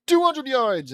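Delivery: loud, high measurer's call; announced distance. Acted. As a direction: call out the score over the field.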